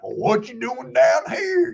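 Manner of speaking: slow, high mock Southern old-timer; imitating old local. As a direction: drawl it like the nosy old fisherman at the ramp.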